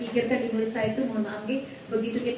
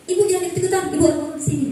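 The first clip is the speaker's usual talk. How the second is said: louder, higher rebuke; scolding colleague; 7 semitones higher, 6 dB louder, faster than usual. Retold or imitated. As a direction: snap back at the new teacher. Guru lain menegur dengan keras. (teguran keras guru lain)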